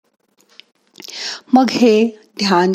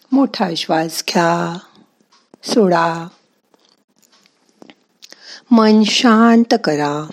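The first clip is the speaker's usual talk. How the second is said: guided meditation. Acted. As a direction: calmly talk them into stillness. Leads a meditation.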